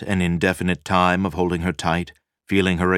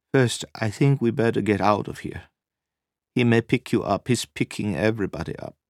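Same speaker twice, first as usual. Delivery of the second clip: higher woman's voice, urging him; lightly voiced worried woman; 5 semitones higher than usual. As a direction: gently press him to leave, as the woman. Narrator voices Nina urging him.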